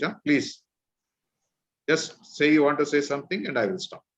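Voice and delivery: ordinary talk, nothing marked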